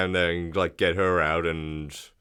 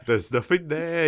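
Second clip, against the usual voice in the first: higher, lighter Aussie imitation; mock Australian accent; 8 semitones higher than usual. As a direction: copy the Aussie line, a bit higher. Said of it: "Australian remake".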